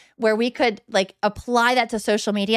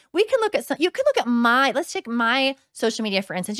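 normal talking voice

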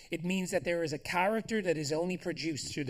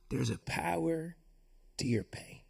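hushed emphasis; 4 dB quieter than usual. Acted. Preacher softens to whisper about power in pain. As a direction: drop to a near whisper on the last words.

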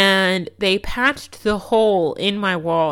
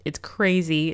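normal talking voice

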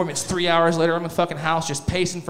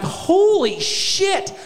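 drawn-out high gasp of shock; shocked yelp; 11 semitones higher, 4 dB louder, much slower than usual. Retold or imitated. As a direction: let it out slow and high like it hurts. Reacting to prostate exam.

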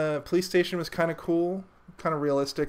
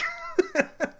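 squeaky high burst of laughter. Real, not acted. Laughing at Jason scene.

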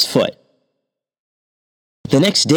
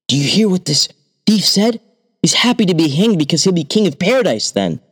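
astonished hangman; 7 semitones higher than usual. A narrator's reading. Hangman reports to king.

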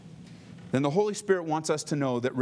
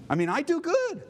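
indignant; 12 semitones higher than usual. Retold or imitated. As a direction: whine that you really are good. Indignant self-defence.

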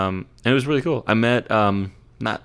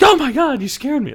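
much higher, shrieked fright; startled wife; 3 dB louder than usual. Retold or imitated. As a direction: mimic her gasping in shock. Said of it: "And she's like".

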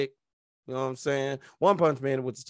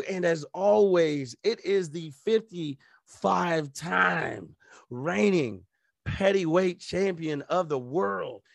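announcer voice; 4 semitones higher, slower than usual. Acted. Self-introduction as champion.

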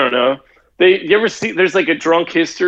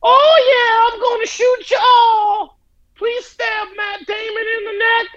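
very high, strained mock gangster voice; Al Capone. Joker imagines the lost recording of the gangster, absurd high squawk. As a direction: squeak out threats as a hammed-up mobster. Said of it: "That must be what he sounded like".

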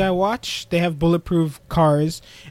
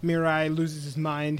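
ordinary talk

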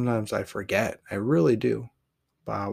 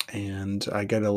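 ordinary talk

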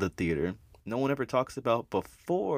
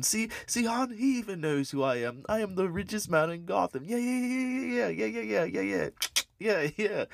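much higher, giddy bragging voice; boastful rich man; 10 semitones higher than usual. Retold or imitated. Thomas Wayne bragging to his wife before the mugger, high and giddy. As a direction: crow about being recognised, pitched way up.

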